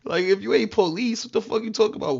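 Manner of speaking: retold heated yell at the fake cops; retold angry challenge. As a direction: push back at them hard. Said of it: "I'm like".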